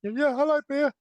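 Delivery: high-pitched character impression, punched out; Bracy. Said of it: "I can imagine Bracy saying".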